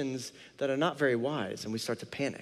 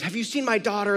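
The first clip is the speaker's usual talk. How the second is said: mildly panicked question, pitched up; retold panic; 6 semitones higher, 3 dB louder than usual. Father asking strangers for his missing daughter, mock panicked. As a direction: imitate a worried parent asking around, higher. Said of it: "all of a sudden panic kicks in".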